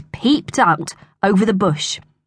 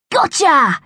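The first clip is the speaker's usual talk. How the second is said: high-pitched cry of capture; triumphant cry; 8 semitones higher than usual. A narrator's reading. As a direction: cry it out as you pounce. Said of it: "he cried".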